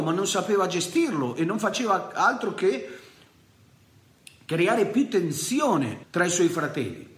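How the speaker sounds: high, fervent preacher's voice; preaching at full force. Acted. Preacher telling the congregation Joseph's fall, fervently. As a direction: preach it to the church with fire.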